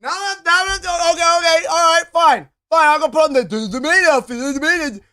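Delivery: normal talking voice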